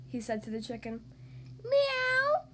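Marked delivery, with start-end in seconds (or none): 1.6-2.4 high, drawn-out cat's meow. Acted. Chicken answers Mister Silly, a meow. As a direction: mew it high like a cat. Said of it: "said the chicken".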